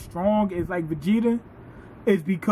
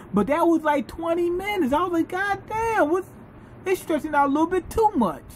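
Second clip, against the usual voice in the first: much higher exasperated; 9 semitones higher than usual. Retold or imitated. His own reaction while watching.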